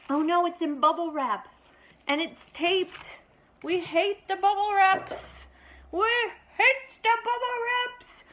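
high, drawn-out whining over bubble wrap; mock dismay. Real, not acted. Owner whining to her dog about the wrapping, high and slow. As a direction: play up the outrage.

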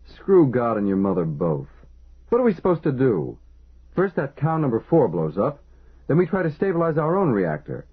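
high, furious ranting outburst. A narrator's reading. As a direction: let the fury spill out. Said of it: "The supervisor rasped furiously".

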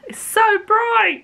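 high, squealing complaint at the glare; sudden high-pitched wail about the sun; dazzled squeal. Real, not acted. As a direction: squeak it out as the sun hits your eyes. Squinting into blinding sun.

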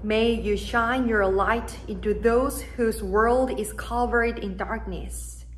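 ordinary delivery

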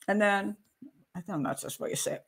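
usual speaking voice

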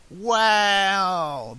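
put-on high, drawn-out boyish amazement; long, high wow of wonder; excited shout. A narrator's reading. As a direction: exclaim like an awestruck little boy. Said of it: "the boy shouted excitedly".

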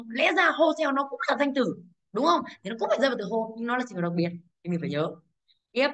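ordinary talk, nothing marked

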